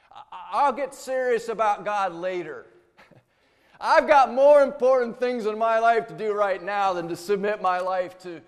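loud, scoffing sinner who laughs God off; mocking laugh. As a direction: laugh it off as if God were a joke. Preacher mimics dismissive sinner.

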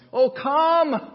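high, loud call to worship; rousing summons. Acted. Psalmist inviting his people to worship, rousing summons. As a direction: cry out the invitation.

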